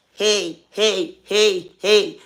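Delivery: usual speaking voice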